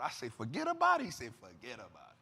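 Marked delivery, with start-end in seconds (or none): 0.3-1.0 high-pitched mock repeat; incredulous echo. Teasing Peter for talking like a gangster, incredulous echo. 1.4-2.2 very low, hushed mock gangster; mobster reply. Peter answering like he's in the mob, low mobster reply.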